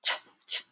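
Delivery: "t, t" as bare voiceless taps; crisp "t" with no vowel after it; isolated T sound. Shown as a demonstration. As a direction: make just the "t" sound, twice, softly. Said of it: "kind of makes the sound here".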